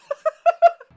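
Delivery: very high, loud fake sobs; hammed-up crying noise; mock sobbing. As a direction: burst into comic, squealing sobs. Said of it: "I begin sobbing".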